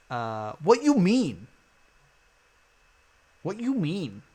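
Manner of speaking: a light hint of a Japanese accent, slow, with stress on you; a hint of a mock Japanese accent, regretted at once. Streamer reads the puzzle phrase in a light mock Japanese accent. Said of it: "like, a racist Japanese accent".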